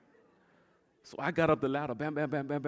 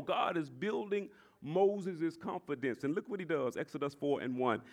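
usual speaking voice

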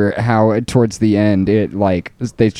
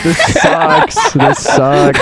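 screechy seagull voice; much slower than usual. Pretend. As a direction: screech it like a gull. Mock seagull squawking, laughing.

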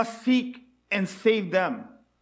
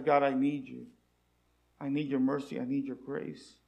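humble plea; 8 semitones lower, 8 dB quieter than usual. Retold or imitated. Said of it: "people who says".

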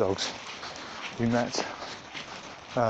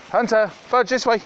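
loud, high call across the field; calling the dogs; 13 semitones higher, 9 dB louder than usual. Real, not acted. Owner calling her dogs back on a walk, loud high call. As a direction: shout them back to heel.

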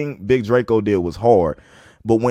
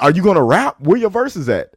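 yelling; 6 semitones higher, 4 dB louder than usual. Retold or imitated.

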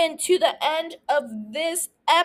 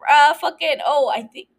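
usual speaking voice

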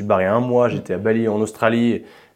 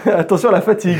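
laughing through the words, pitched up; 9 semitones higher, 5 dB louder than usual. Real, not acted. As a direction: giggle while reading the quote. Laughing while quoting school report.

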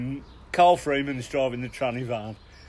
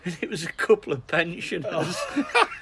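laughing at his own punchline, higher; 5 semitones higher than usual. Real, not acted. Telling the pensioners twist, laughing. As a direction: laugh out the reveal.

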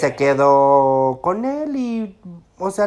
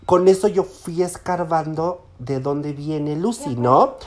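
normal talking voice

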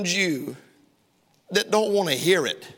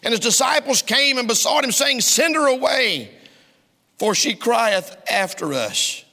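full-force preaching; 7 semitones higher, 4 dB louder than usual. Acted. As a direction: draw it out from the pulpit. Preaching the disciples' words.

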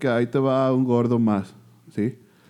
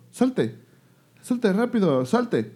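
mock kick-out order; 9 semitones higher, much slower than usual. Mock telling the gatecrasher to leave.

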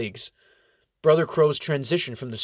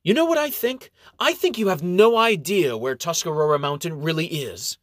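red-faced outburst; 7 semitones higher, 5 dB louder than usual. A narrator's reading. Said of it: "his face turning red".

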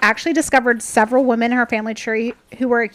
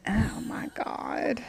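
whispered; 2 semitones lower, 14 dB quieter, much slower than usual. Real, not acted. Shocked aside to co-host.

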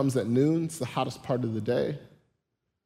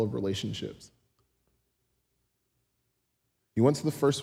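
tender Jesus; 2 semitones lower than usual. Retold or imitated. Jesus speaking tenderly to woman.

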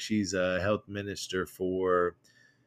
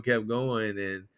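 ordinary delivery

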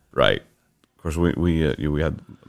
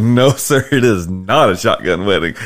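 insisting; 4 semitones higher, 7 dB louder than usual. Retold or imitated. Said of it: "I'm like".

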